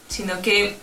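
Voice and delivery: normal talking voice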